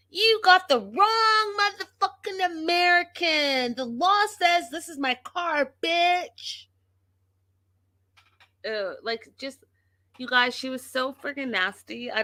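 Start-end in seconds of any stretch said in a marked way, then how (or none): 0.1-6.7 high-pitched imitation of screaming; retold scream. Vlogger imitates wife taking off his plates, high mock scream. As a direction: mimic a screeching rant, pitched way up.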